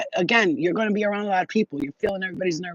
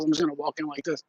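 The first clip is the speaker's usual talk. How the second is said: normal talking voice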